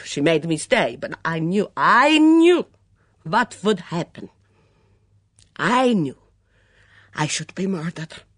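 put-on Mitzi, hunted and defiant, in a heavy foreign accent; a suspicious foreign cook in a thick accent, rising to outbursts. Mitzi voiced by narrator.

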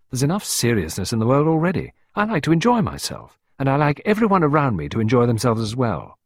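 a hint of Clarissa, pleased and airy; Clarissa's voice. A narrator's reading. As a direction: voice her as a cheerful young wife.

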